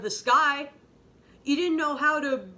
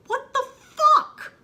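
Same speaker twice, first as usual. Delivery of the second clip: much higher and louder, with a laugh; shrieking disbelief; 10 dB louder, slower than usual. Real, not acted. Outraged disbelief at a plot twist.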